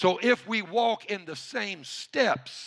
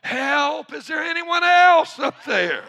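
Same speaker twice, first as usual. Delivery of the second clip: high, very loud punchline shout; acted full-voiced yell, pitched way up; shouting for help; 11 semitones higher, 10 dB louder than usual. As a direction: holler upward for some other rescuer. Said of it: "Jack was heard to shout".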